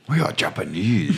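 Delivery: lightly imitated Japanese accent; mock Japanese accent. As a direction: fake Japanese accent for film aliens.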